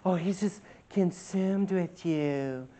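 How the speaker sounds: soft, slow, syrupy mock; mocking the gushing churches; mocking gushy churchgoer. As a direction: quietly parody the sappy preacher. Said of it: "how all these churches want to make it sound like".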